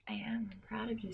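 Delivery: soft breathy whisper of mock pride. Whispering mock pride to the co-host. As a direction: lean in and whisper it like a secret.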